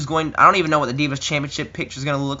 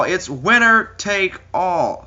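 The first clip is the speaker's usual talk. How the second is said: higher, very slow announcer boom; 5 semitones higher, 3 dB louder, much slower than usual. Pretend. Ring announcer repeating the winner take all call.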